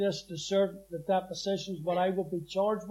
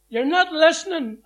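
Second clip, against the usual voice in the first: higher, louder scolding mother; mother shouting; 8 semitones higher, 9 dB louder than usual. Retold or imitated. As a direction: snap it as a fed-up mother. Mother scolding son in cells.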